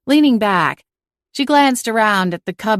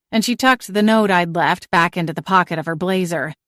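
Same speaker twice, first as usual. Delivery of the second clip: plain reading voice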